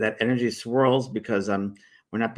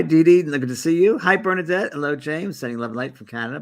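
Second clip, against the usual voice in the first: ordinary talk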